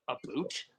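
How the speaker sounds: "boot" with an oo vowel, low and soft; mock Canadian "about". Shown as a demonstration. Podcaster puns on leg and foot, low "A boot" as Canadian "about". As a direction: drop low and quiet on "A boot", meaning "about". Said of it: "they think we talk like that".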